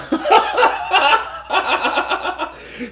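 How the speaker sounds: high, loud burst of laughter. Real, not acted. Teller laughing mid-story, high laugh. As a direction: break into laughter.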